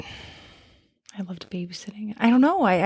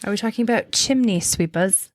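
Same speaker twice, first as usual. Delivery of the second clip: playful put-on British accent. Co-host guessing the era for host, British accent. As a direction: ask it like a mock Brit. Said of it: "bust out your British accent".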